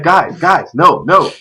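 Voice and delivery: imitated pleading without yelling; Parasite. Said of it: "He doesn't even yell".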